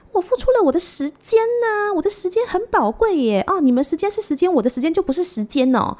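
mocking high whiny voice of the agent.

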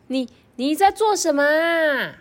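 high, curious question; curious caterpillar. Acted. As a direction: ask like a nosy little bug. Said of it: "豆豆就很好奇的问他".

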